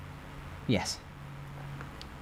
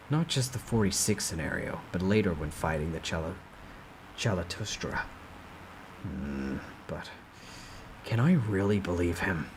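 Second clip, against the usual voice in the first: low, softer doubtful musing; Yu Jong-hyuk pondering; 5 semitones lower, 5 dB quieter than usual. Acted. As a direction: weigh the offer quietly, unsure.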